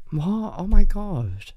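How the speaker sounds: ordinary delivery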